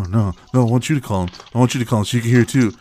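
ordinary delivery

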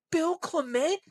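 high, stunned question, the name pulled up; realizing who's in the garage; surprised recognition. Real, not acted.

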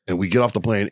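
ordinary talk, nothing marked

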